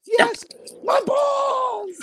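very high mock agony; wrestler's pained shout. Wrestler just hit in the nuts, agonized shout. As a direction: holler in comic groin pain. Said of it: "somebody hit him in the nuts".

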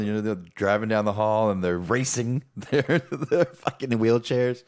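words breaking into real laughter; chuckling at the memory of the wheelchair race; laughing while telling. Real, not acted. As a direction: laugh through the punchline.

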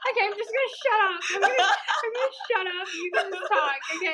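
high, fast laughing resignation. Real, not acted. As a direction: give up while crying with laughter. Embarrassed giving up the floor.

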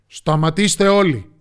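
loud, booming lion's command; storybook king's thunderous voice; thundering shout. A narrator's reading. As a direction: order everyone to halt. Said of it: "με βροντερή φωνή".

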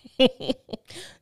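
loud rising laugh at her own confession; burst of laughter. Real, not acted. Laughing loud after admitting she won't pay the doctor. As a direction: laugh out, sudden and amused.

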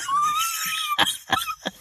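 high, crying-like laughter; wailing laugh. Real, not acted. Laughing hard after quoting the freak-out. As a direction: dissolve into laughter.

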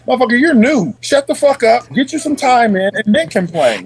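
high-pitched, exasperated telling-off; acted scolding. Veteran to a complaining new guy, exasperated scolding. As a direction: tell off the newcomer in mock confrontation.

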